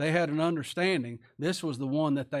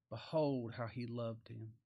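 slow, low, soft repeat; quiet, lingering scripture quote; hushed reverence; 4 semitones lower, 8 dB quieter, slower than usual. Shown as a demonstration. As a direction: let the verse sink in softly.

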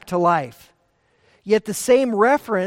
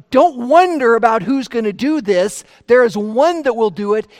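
urgent preaching; 3 semitones higher, 6 dB louder than usual. Real, not acted. Preacher urging his congregation.

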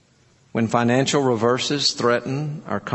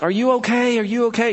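high-pitched imitation of an anxious mom; worried mother; 10 semitones higher, faster than usual. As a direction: call to a child through the door. Worried mother calls through door.

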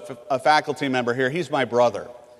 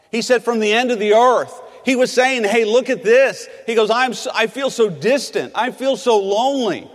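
raised, higher, louder cry of David's lament; impassioned preaching; 8 semitones higher, 5 dB louder than usual. Acted. Preacher voicing lonely David to the congregation, raised and pleading. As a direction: preach it at full force, pleading.

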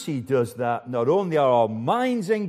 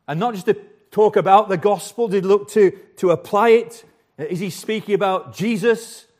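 louder, rising preacher's appeal, pressing each question; fervent pulpit questions, building louder; impassioned preaching; 5 semitones higher, 6 dB louder than usual. Acted. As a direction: drive the questions home to the congregation.

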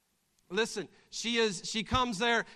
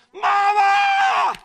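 much higher, much louder call; girl yelling for mama; 18 dB louder than usual. Acted. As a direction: yell it like a kid bursting in. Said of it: "You would be running as far".

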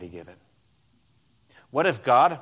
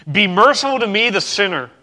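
pleading sinner's cry; 8 semitones higher, 10 dB louder than usual. Retold or imitated. Quoted plea of tax collector.

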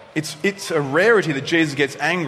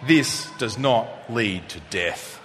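slow, softer, like words carved in stone; solemn epitaph; 5 semitones lower, 3 dB quieter, slower than usual. Shown as a demonstration. Pastor telling the congregation what to put on a gravestone, slow and calm. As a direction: read it slowly as a calm gravestone line. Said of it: "you could write on your gravestone".

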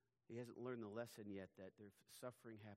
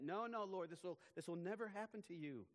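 raised, higher, insistent no, no; alarmed protest; 8 semitones higher, 4 dB louder than usual. Acted. Peter protesting to Jesus, loud and high alarm. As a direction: Peter cries out against it, pitch high.

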